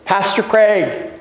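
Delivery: high-pitched imitation of a toddler; Ben, little child's voice. Toddler answering the pastor from his car seat, little voice. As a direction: pipe up like a small child, drawn out. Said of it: "I'll hear this little voice say".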